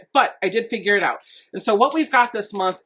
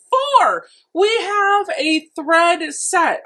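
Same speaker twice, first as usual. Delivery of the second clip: excited reveal; 9 semitones higher, 5 dB louder, much slower than usual. Real, not acted. Unboxing reveals four thread spools.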